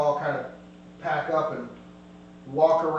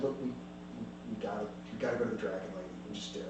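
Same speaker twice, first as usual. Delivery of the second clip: quiet urging; 7 semitones lower, 13 dB quieter, much faster than usual. Acted. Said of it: "Cedric looks at you guys and goes".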